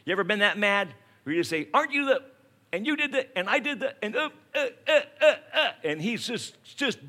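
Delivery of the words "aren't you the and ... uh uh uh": higher, stammering livid cop; sputtering rage. Retold or imitated. Furious cop sputtering at the repeat speeder, higher. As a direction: choke on the words, too mad to finish. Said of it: "so mad he can't even finish his sentences".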